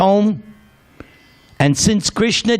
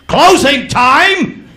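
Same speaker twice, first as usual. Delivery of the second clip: booming drill sergeant call, high and loud; Marine sergeant; 9 semitones higher, 6 dB louder, slower than usual. Retold or imitated. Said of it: "he sounded like a Marine sergeant".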